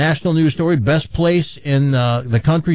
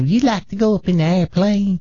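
put-on Southern accent; 4 semitones higher than usual. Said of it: "with a thick Southern accent".

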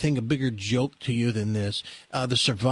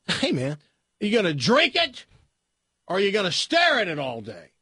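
a hint of a biker, higher and louder; 8 semitones higher, 3 dB louder than usual. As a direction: taunt him like a pushy biker. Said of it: "the biker looks at him and goes".

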